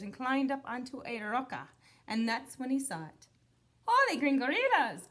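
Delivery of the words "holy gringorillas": loud startled gasp of words; gulped. A narrator's reading. Kitty boy spotting a Martian, loud shocked blurt. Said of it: "gulped Scapito".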